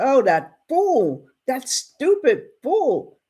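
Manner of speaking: high, drawn-out indignation; acted upset. Townsman fuming about a foolish rancher, acted upset. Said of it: "Now Bass is getting upset".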